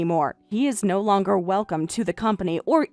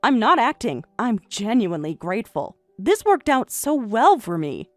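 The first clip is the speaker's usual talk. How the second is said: calm gratitude; 7 semitones lower, 4 dB quieter than usual. Acted. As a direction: lower and softer, plainly sincere. Wife insists she is grateful.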